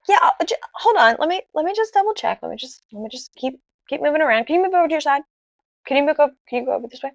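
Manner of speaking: high, hesitant instructions; hedging sonographer. Retold or imitated. Retold sonographer hedging, double checking.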